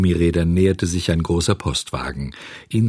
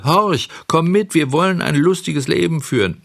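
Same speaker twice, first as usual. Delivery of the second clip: put-on coaxing boy; 9 semitones higher, 3 dB louder than usual. A narrator's reading. Röhrle coaxes from the wagon.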